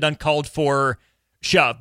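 ordinary talk, nothing marked

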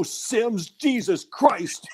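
drawn-out imitation of the Jersey coach needling a player; Parcells jab. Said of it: "Bill Parcells became that Jersey guy that was always there with remarks".

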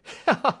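high, loud acted chuckle; short, merry character laugh; jovial laugh. A narrator's reading. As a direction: laugh out as the amused inspector.

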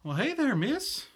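put-on very high little-boy greeting; high-pitched young lad. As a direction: greet her in a small boy's high voice. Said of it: "young, young boy".

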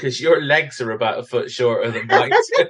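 laughing through the words. Real, not acted. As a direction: laugh out the tease. Teasing friend, bursts out laughing.

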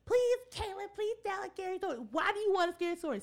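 put-on high, fast whiny child pleading; begging kid voice. Kids begging, mimicked high.

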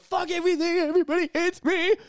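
high-pitched, whiny self-pity; hammed-up bad-day meltdown; mock despair. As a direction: wail like everything is against you.

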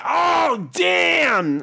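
impressed shout. Retold or imitated. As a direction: yelp it like he just got roasted. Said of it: "He was like".

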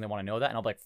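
ordinary talk

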